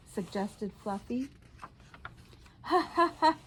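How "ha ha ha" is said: high laughing ha ha ha; a helpless fit of laughter. A narrator's reading. Rhinoceros cracking up over his own name. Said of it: "I'm laughing so hard".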